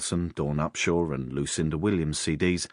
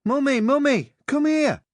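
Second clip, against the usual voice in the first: put-on high, loud little boy shouting for his mother; shrill-pitched boyish yell from another room; eight-year-old boy calling; 8 dB louder than usual. A narrator's reading. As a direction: call out urgently as an excited child. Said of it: "He was only eight".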